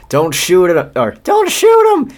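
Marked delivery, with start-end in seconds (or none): 1.2-2.1 a very high, squeaky warden voice; the warden's voice. Acted. Reading the warden's order to the guards in a very high squeaky voice. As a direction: redo the line as a shrill cartoon warden. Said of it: "said the warden".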